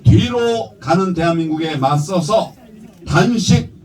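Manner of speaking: rousing political speech; formal speech. Real, not acted. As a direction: deliver it like a campaign address. Prepared speech read at rally.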